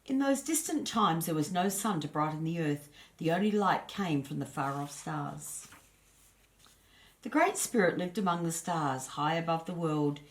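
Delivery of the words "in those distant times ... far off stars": plain reading voice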